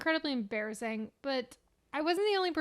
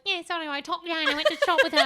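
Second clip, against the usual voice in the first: rushed, louder, higher mock British; parroted British accent; 5 semitones higher, 5 dB louder, much faster than usual. Parroting passers-by to mom, fast mock British accent. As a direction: rattle it off in exaggerated British. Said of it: "repeating British shit I heard".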